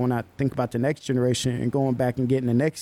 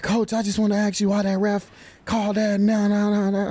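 imitating upset kid; 7 semitones higher than usual. Kid protesting the ref's call.

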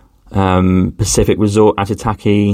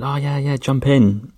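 friendly Aussie; 6 semitones higher, 3 dB quieter than usual. Retold or imitated. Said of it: "She said".

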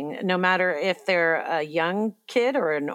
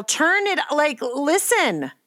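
high, raised mock yell at the phone; 9 semitones higher, slower than usual. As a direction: half-shout it, pitched up. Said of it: "I wanted to yell".

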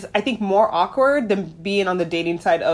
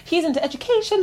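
higher, eager and bright; gushing friend; 9 semitones higher, 4 dB quieter than usual. Retold or imitated. New friend quoted eagerly, higher pitched. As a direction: gush about the husband.

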